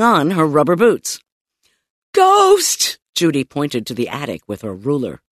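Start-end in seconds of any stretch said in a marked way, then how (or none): 2.1-3.0 high-pitched shriek; frantic kid raising the alarm; alarmed cry. A narrator's reading. Girl alerts mother, pointing at attic.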